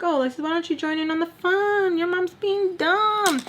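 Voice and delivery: high, sing-song mocking voice; imagined naive listener. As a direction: mimic a clueless cheerful onlooker. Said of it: "you're probably thinking".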